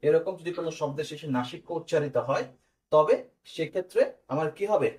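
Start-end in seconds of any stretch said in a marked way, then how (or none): none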